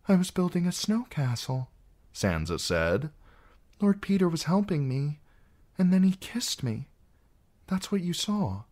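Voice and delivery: a niece explaining to her aunt; young Sansa. Acted. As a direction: explain carefully, scared.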